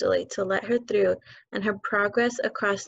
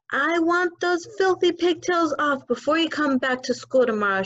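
barked; 7 semitones higher than usual. Acted. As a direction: bark it at the girl. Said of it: "she barked".

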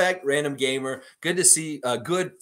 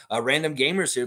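normal talking voice

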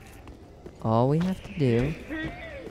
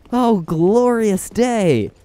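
slow, high, louder mock rapture; mock grand joy; 8 semitones higher, 6 dB louder, much slower than usual. Real, not acted. Gamer thanking a kind online player, mock rapture. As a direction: savour "glorious day" like a hymn.

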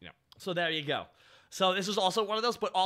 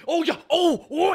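loud, high wrestler's hype cries; intense wrestler; 10 semitones higher, 10 dB louder than usual. Retold or imitated. Fan imitating the performer's wrestler intensity, loud and high. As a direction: belt out mock pro-wrestling bellows. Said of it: "the intensity of a wrestler".

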